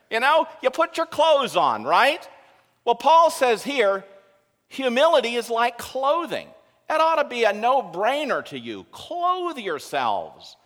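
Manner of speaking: loud, high, driving sermon delivery; full-force preaching. Acted. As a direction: preach it out to the whole congregation.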